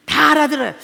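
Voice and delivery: ordinary talk, nothing marked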